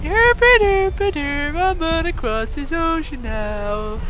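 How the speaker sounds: Jenna Marbles voice. Acted. Voicing the mountain giant like Jenna Marbles, high and goofy. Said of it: "I sound like Jenna Marbles".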